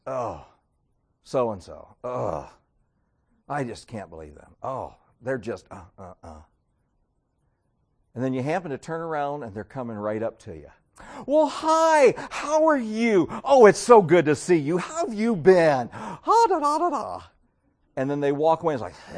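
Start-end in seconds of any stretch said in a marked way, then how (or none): 0.1-6.4 low, soft disapproving gossip; hushed tut-tutting about someone; gossiping under breath. Retold or imitated. 11.3-17.2 mock sugary hello, louder; fake friendly greeting. Fake greeting to gossip target.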